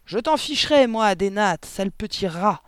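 loud, high angry shout. A narrator's reading. Headmistress swings Amanda by the plaits, shouting. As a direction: shout it while swinging her round. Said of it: "tout en criant".

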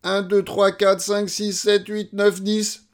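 high, unbroken rattled count; all in one breath. Acted. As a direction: count without pausing for breath. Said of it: "dit d'une seule traite". (compté d'une seule traite)

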